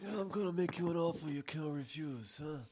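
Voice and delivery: high, soft-spoken mob boss impression; Godfather. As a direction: make a mock mafia offer in a put-on voice. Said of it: "Godfather, they were spot on".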